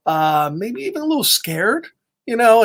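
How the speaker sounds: ordinary delivery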